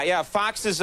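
normal talking voice

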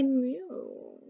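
soft, high little meow; kitten mew. A narrator's reading. Hidden kitten heard beneath bamboo, soft high mew. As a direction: mew it gently like a small kitten. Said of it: "they heard someone say".

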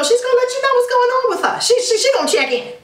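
high, fast and bright with excitement; excited to tell viewers mom will visit. Real, not acted. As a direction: rush it out, thrilled about mom. Excited about mom's visit.

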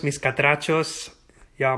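normal talking voice

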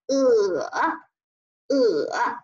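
呃 dipping low, then rising; drawn-out dipping 呃; third tone. Shown as a demonstration. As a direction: bend 呃 down and back up. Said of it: "三声拐弯了读呃".

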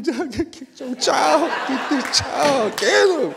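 high, slow, laughing mock plea; hammed-up desperate handback, cracking up. Volunteer begging the mother to take her child back, high laughing plea.

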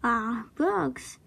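plain reading voice

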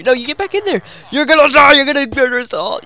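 high, loud yell at a player; frantic high shout. Real, not acted. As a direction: yell them back into the room. Yelling at player in game.

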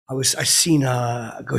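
ordinary talk

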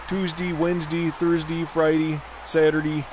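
"Tuesday", "Wednesday", "Thursday", "Friday", "Saturday" all ending in "dee"; days ending in "dee". Retold or imitated. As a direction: lightly mimic the teacher's "dee" endings on every day. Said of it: "but D E E".

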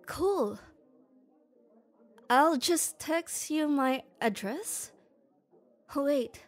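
ordinary delivery

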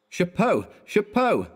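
high, falling mock-furious cry. A narrator's reading. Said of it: "there was a furious cry of".

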